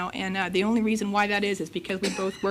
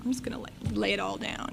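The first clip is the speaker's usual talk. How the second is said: unvoiced whisper, softer; whispered plan; 6 dB quieter than usual. Telling herself her plan under her breath, whispering.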